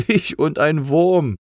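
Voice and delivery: high, slow, drawn-out outrage; indignant huff. A narrator's reading. Little C mistaken for a worm, indignant. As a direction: puff it out, thoroughly affronted. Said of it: "Entrüstet schnaufte das kleine C".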